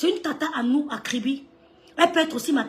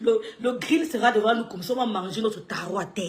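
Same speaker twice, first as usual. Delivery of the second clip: ordinary talk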